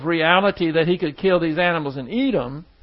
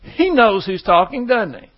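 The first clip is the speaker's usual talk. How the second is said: normal talking voice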